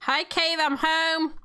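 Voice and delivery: high, sing-out hello; calling out greeting. Pretend. Character arriving home to Cave, high called greeting.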